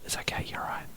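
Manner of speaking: mock faint whisper over the headphones; imitating faint voices. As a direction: whisper it as if barely heard through the headphones. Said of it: "they're really faint".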